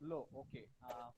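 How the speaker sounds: faint low murmur; almost too soft to hear; barely audible. Real, not acted. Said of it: "Low, sir, low".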